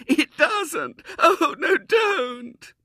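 very high, loud tearful cry; wailing little girl. A narrator's reading. Girl begging William to stop, tearful wail. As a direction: plead through loud sobs. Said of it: "she wailed".

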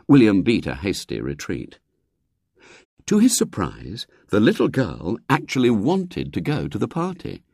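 plain reading voice

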